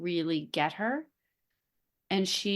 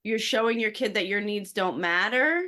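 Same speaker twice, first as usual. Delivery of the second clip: judging listener; 3 semitones higher, 4 dB louder than usual. Acted. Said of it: "let me just tell you what they're thinking".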